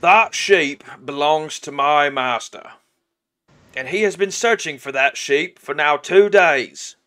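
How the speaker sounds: self-important orc on his master's errand; insistent orc henchman. Acted. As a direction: lay down the demand forcefully. Plays the orc.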